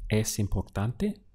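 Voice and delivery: "Es importante" asked, rising at the end of "importante"; question intonation. Shown as a demonstration. Teacher models asking if it's important, "Es importante" with a final rise. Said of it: "Now ask".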